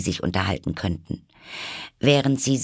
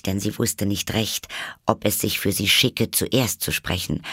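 plain reading voice

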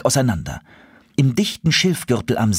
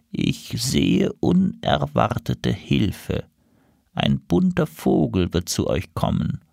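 slow, drawn-out trance voice; seer crow prophecy; 4 semitones higher, slower than usual. Acted. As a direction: intone it like an oracle. Seer crow eyes closed, prophesying.

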